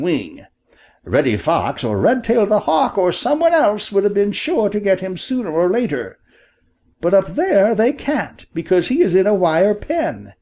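animated storybook bird, a bit high; father bird, reassuring. Acted.

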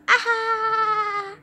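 long, high mock cry; mocking aha. Buddy brushing off the threat, high mocking cry. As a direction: draw out a high, scornful aha.

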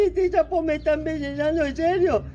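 high, alarmed disbelief; a monster suddenly fearing poison; shocked monster. Acted. Monster realizes the frog poisons him, high and shocked.

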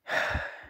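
long exhaled sigh; real wordless sigh. Real, not acted. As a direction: breathe out a sigh before going on. Long pause before concluding the story.

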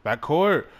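high, loud questioning yelp; startled high call-out; real sudden yell. Real, not acted. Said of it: "Why did I yell backcourt?".

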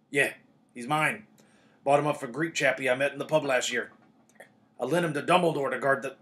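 loud, big-voiced Hagrid, explaining; proud Hagrid. A narrator's reading. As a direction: boom it out as the dog's owner. Plays Hagrid explaining his dog.

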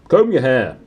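loud barked order. Acted. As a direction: bark it like a gruff uncle. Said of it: "he barked".

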